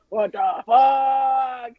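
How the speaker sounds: drawn-out stunned shout; inner shock. Retold or imitated. Retelling her shock at seeing Stephanie McMahon, a slow stunned shout. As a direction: blurt it out in disbelief.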